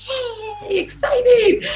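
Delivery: high, over-the-top squeal of joy; hammed-up excited squeal with a laugh; mock excitement. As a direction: fake a bright, gushing cheer. Mocking her own fake enthusiasm.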